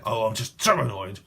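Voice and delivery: acted frustration, leaning hard on "so"; mildly exasperated outburst; channeled annoyance. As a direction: voice someone else's exasperation. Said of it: "I'm channeling someone's frustration here".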